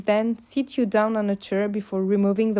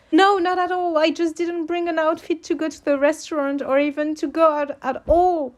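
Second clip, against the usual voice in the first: flustered; 8 semitones higher than usual. A narrator's reading. Flustered reply, high pitch.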